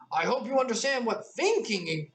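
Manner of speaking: put-on lifted, girlish Alice line. A narrator's reading. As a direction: pitch it up as the young girl. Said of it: "I'm sorry".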